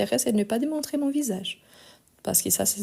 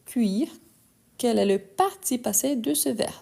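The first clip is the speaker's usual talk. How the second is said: usual speaking voice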